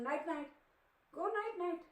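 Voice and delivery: high, sing-song dog talk; high cooing to the dog. Real, not acted. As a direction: coo bedtime at the pet. Talking to her dog.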